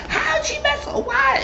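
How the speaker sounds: very high, laughing mock voice; mock squeaky question. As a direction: squeal it in disbelief. Voicing Paris, laughing.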